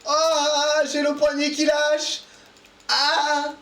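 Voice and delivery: high strained cry. Real, not acted. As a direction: cry out as your grip fails. Wrist giving out.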